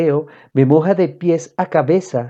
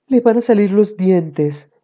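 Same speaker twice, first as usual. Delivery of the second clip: higher, slow mom voice; higher-pitched, drawn-out mom; mother's voice; 6 semitones higher than usual. A narrator's reading. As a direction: play a mother explaining the baby's teething. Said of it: "dijo mamá".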